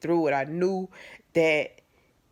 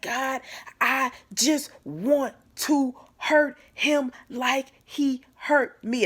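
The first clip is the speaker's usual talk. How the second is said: higher, slow, seething plea to God; a slow, pitched-up cry of rage aimed at God; furious prayer; 7 semitones higher, slower than usual. Retold or imitated. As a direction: drag out every word of the vengeful prayer. Retells her furious prayer.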